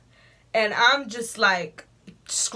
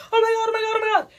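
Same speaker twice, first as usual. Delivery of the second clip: high, loud, alarmed repeating; a much higher mock panic; imitating a panicked girl; 6 dB louder, faster than usual. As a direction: play the shocked sixth grader. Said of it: "she grabs me and she's like".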